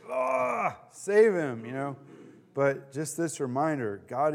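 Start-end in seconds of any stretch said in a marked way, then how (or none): 0.1-1.6 retold desperate plea. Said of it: "mad at him and sad".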